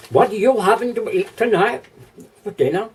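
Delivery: a put-on British accent, pitched high; British accent attempt. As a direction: try to sound British while asking about dinner. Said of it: "Trying to talk British".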